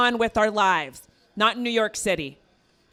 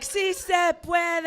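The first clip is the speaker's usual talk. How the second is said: high, slow-stretched slogan; drawn-out rallying cry; 6 semitones higher, slower than usual. Acted. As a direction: stretch it out like a proud chant. Acting out her slogan shouting.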